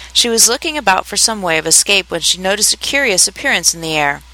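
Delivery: plain reading voice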